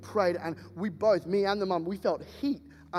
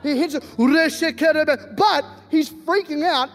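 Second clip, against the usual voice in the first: ordinary delivery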